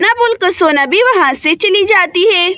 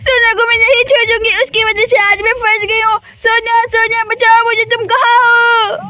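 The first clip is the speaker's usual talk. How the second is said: sobbing cries for help. Acted. Sweety, trapped in the bathroom, wails to her sister for help. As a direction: beg through tears as the snake closes in.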